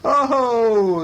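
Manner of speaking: loud, high, drawn-out "oh"; delighted exclamation. A narrator's reading. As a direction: swoop down from a high, showy start. Book animal exclaims in delight.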